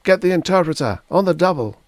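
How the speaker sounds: high, loud urgent command; yelled order. A narrator's reading. Launching agent summoning the interpreter, yelled order. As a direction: bark it across the launching bowl.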